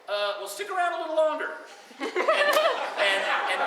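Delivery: words breaking into laughter; laughing. Retold or imitated. Steve barely holding in laughter on the phone. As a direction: fight back laughter while talking.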